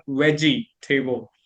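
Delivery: "veggie table" with full ee and long A; mistaken pronunciation. Shown as a demonstration. Learner explains her old reading, two-word pronunciation. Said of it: "So we, we think".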